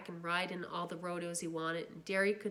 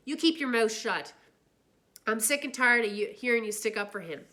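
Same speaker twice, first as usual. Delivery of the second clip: loud, higher angry snap; turning on him, furious; 4 semitones higher, 9 dB louder than usual. Acted. Older brother turning angrily on the middle brother. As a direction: cut the other brother down. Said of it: "Derry turned on him".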